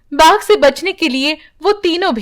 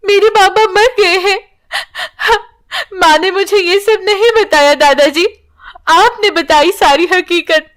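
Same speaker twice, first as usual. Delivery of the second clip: crying; 7 semitones higher than usual. Acted. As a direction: sob the words out, heartbroken. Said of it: "वो रोने लगती है".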